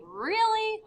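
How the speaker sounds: high, doubtful query; unsure, questioning. Shown as a demonstration. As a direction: question it as if you don't quite believe it. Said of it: "like, you're not sure about something".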